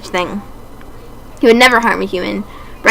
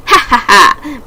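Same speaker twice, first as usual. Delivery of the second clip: mad laugh; 3 semitones higher, slower than usual. Acted. Higure bursting into a mad laugh at the others. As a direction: laugh like a crazed prophet.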